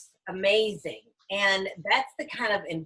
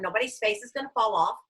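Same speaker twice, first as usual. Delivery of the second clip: ordinary talk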